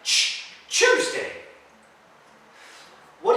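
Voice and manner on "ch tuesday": "Ch" alone, then "Tuesday" as CHOOZ-day; sound demo. Shown as a demonstration. Teacher showing the C H sound, correctly pronounced. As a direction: isolate a voiceless CH, then say "Tuesday" starting with it. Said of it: "Como C H".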